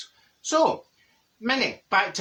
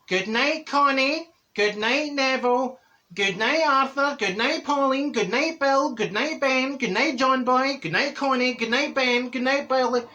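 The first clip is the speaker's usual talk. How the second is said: high singsong round of goodnights; calling good night; 7 semitones higher than usual. Retold or imitated. Houseful of relatives saying good night, mock singsong calling. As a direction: lilt each name up high, one after another.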